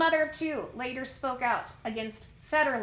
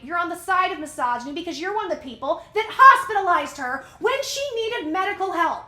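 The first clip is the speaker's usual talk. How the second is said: loud, high-pitched indignant rant; really angry, punching out the challenges; mad rant; 4 semitones higher, 5 dB louder than usual. Real, not acted. Host scolding the ex-husband over misogyny, mad rant. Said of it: "I'm sorry, I'm getting mad".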